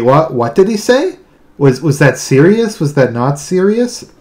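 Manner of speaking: ordinary talk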